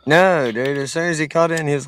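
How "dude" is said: ordinary talk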